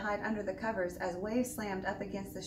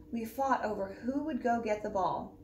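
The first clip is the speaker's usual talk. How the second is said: ordinary delivery, nothing marked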